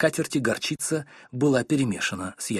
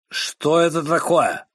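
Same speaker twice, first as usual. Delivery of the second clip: slow, loud, higher frowning demand; 5 semitones higher, 5 dB louder, slower than usual. Acted. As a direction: growl it out like an angry husband. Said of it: "нахмурился мистер Корнер". (хмурый громкий вопрос)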